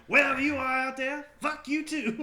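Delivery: high, loud impassioned Pacino; Al Pacino shout. Retold or imitated. Repeating Pacino courtroom line.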